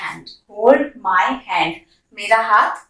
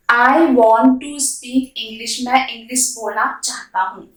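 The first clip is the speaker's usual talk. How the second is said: ordinary talk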